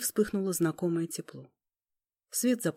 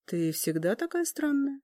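thoughtful; 7 semitones higher than usual. Acted. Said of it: "задумчиво поинтересовалась Тьма".

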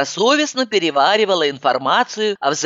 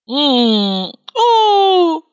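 knowing exclamation; 11 semitones higher, 4 dB louder than usual. Acted. Wordless cry of realisation.

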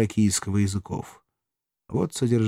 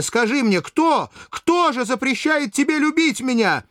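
much higher, rising into frantic demands; desperate pleading; 11 semitones higher than usual. Acted. Valeria begs Spartacus to name who forbids their love, frantic. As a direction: press him harder with every question, climbing higher.

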